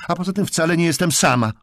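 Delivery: loud, high defiant cry; exclaimed. A narrator's reading. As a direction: call it out in indignation. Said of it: "wykrzyknęła".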